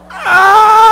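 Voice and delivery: loud, high mock baby wail; imitated baby scream. Dad imitating his baby daughter's hair-pulling scream. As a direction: screech it like a frantic infant.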